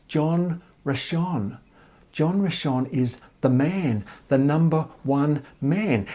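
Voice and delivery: very slow, weighty delivery; drawn-out, deliberate reveal of a big name; awed emphasis. Retold or imitated. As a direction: spell out his importance word by word. Stressing who the important man is.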